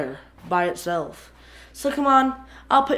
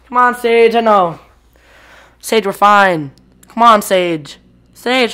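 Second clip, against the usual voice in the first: calling out; 11 dB louder, slower than usual. Real, not acted. Calling the scared cat loudly.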